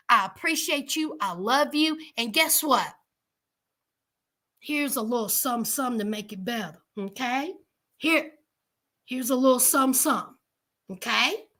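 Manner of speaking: tender, grateful pretend apology; sweet apology. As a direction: coax Mama with thanks and a little money. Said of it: "You can say".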